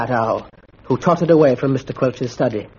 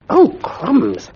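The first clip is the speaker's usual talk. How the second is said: high gasp of greed; delighted gasp; 8 semitones higher than usual. A narrator's reading. Bunter gasps at the strawberries, pitched high. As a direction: gasp at the feast. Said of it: "gasped Bunter".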